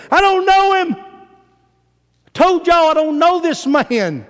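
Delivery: loud, very high angry outburst; Peter ticked off, swearing. Acted. Peter tells accusers he doesn't know this man, shouting angrily. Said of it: "He cussed them and swearing to them".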